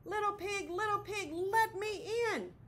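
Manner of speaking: wolf at the house of sticks; howling wolf. Acted. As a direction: howl it at the door like the wolf. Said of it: "howled the wolf".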